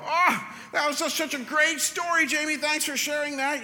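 high gushing mock praise; mimicking a churchgoer gushing over the story. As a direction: squeal it like an eager fan after service. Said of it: "everybody comes up to me afterward and go".

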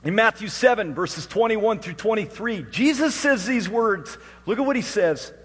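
high, loud preacher's cry; loud, high fervent preaching; full-force preaching. Acted. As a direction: preach it out to the whole room.

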